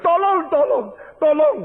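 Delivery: high, loud call of help; panicked yell for help from the riverbank; cry for help. Retold or imitated. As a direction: shout for help as someone drowns. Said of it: "Lalu orang mengatakan".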